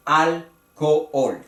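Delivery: slow "alcohol" as al-co-ol; "alcohol" low and slow, hiatus between the o's; three syllables. Shown as a demonstration. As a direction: divide "alcohol" into al, co, ol. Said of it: "tres sílabas".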